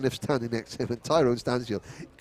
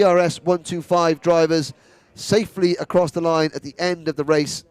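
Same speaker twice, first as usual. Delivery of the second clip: higher, louder finish-line commentary; excited race call; 5 semitones higher, 5 dB louder than usual. Real, not acted. Commentator calling the kart race finish, excited and loud.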